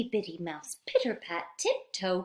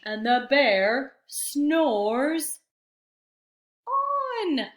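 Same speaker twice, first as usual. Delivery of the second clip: stretched, sing-song refrain; slow drawn-out refrain; 5 semitones higher, 5 dB louder, much slower than usual. A narrator's reading. Teacher stretching the refrain as the bear sleeps on. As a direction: drag out snores, lift on.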